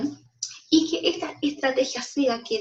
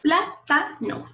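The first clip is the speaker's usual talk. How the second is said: 'Plátano' slowly split as plá-ta-no; syllable by syllable; 4 semitones higher, much slower than usual. Shown as a demonstration. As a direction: break 'Plátano' into three separate, higher syllables. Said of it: "Separémoslo en sílabas". ('Plátano' dicho lento, separado en sílabas)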